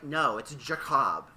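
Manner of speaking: slow, firm correction; slow insistent quote. Retold or imitated. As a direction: drag it out stubbornly. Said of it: "And they're like".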